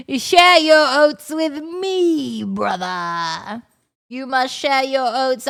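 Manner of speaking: slow, theatrical plea with loud swells; melodramatic doomed brother. Acted. One brother begging another to share the oats, hammed-up dread. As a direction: declaim it like an overwrought tragedy. Plays a tale's character.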